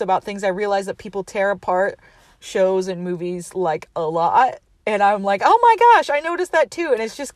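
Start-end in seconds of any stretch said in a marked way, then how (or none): 5.4-6.9 suddenly thrilled to agree; mock fangirl squeal; excited gush. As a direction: squeal it, much higher and louder. Excited self-quote about podcasts.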